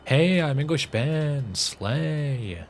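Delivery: slow, high, drawn-out gushing introduction; hammed-up queeny greeting, vowels stretched long; slay voice as real voice. Streamer claiming this is his real accent, hammed-up slay voice. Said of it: "I actually just talk like what I was doing earlier".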